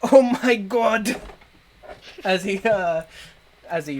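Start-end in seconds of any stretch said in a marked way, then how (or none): 0.0-1.2 loud, drawn-out cry, higher pitched; shouting. Acted. Turtle floating away down the river, shouting. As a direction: yell it out slowly as you're carried off. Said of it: "you can hear the turtle shouting".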